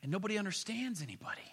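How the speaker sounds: hushed, quick whisper; soft quick whisper to the congregation. Real, not acted. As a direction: drop to a whisper for the point. Whispered aside after the chaos.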